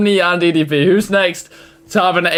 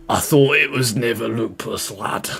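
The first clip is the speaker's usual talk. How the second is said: lightly imitated Scottish accent, lower voice; 7 semitones lower, 4 dB quieter than usual. Streamer mimics Rocket Doc's lupus line, light Scottish accent. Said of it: "I can hear the Scottish".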